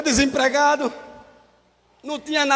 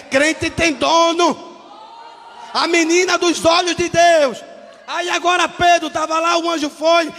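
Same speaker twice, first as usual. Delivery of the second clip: fiery preaching; 4 semitones higher, 3 dB louder than usual. Acted. Preacher declaring to the church that believers have an owner, loud. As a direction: hammer each word home.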